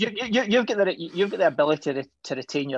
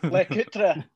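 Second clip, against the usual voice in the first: higher, laughing put-on Scottish accent; best Scottish accent; 4 semitones higher than usual. Shown as a demonstration. Repeating the watch name for laughing co-hosts, best Scottish accent. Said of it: "In my best Scottish accent".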